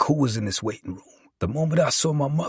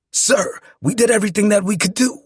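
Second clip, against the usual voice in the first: higher, louder insistence; lightly voiced doctor; 7 semitones higher, 5 dB louder than usual. A narrator's reading. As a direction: insist to an angry relative, raised voice. Doctor pleads with angry husband.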